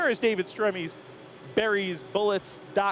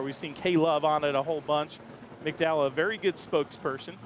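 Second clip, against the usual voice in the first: ordinary delivery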